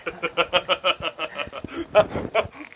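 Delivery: long chuckle in a steady run; amused laugh. Real, not acted. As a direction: laugh it out at length. Laughing while checking the food.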